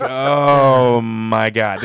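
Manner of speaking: very slow, drawn-out mock shock; slow, dragged-out stunned reaction; stunned disbelief. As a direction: stretch it out like you can't believe your eyes. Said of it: "we all look at each other like".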